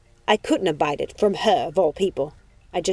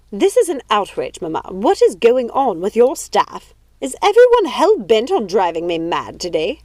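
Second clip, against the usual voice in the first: indignant outrage; 2 semitones higher, 6 dB louder than usual. Acted. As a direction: rant at the staff like an outraged lady. Lady rages at her mother's staff.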